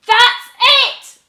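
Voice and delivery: very high, very loud scream; screaming in rage. Acted. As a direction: explode with rage. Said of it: "screamed the witch".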